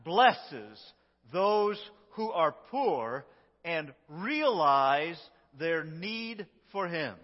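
slow it right down so each word lands; very slow emphatic reading. Shown as a demonstration. Pastor leading the congregation's reading, very slow and emphatic. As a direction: read every word very slowly, leaning on each one. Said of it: "go very slow so that we can emphasize".

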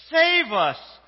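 very loud, high-pitched shout; belted cry, falling at the end; shouting together. Shown as a demonstration. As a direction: lead the congregation in a full-out shout. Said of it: "let's shout together".

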